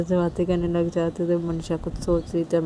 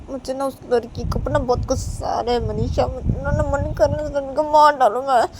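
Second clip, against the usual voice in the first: raised, heated, building to a furious shout; angry friend; 6 semitones higher, 4 dB louder than usual. Acted. Nisha to Manisha, furious at the rival. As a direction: vent rage at the girl.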